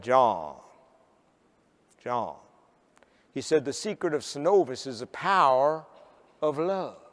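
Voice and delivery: low, slow Southern drawl with long pauses; strong Southern drawl. Retold or imitated. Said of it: "In his strong Southern drawl".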